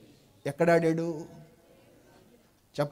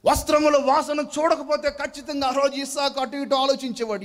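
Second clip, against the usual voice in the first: ordinary talk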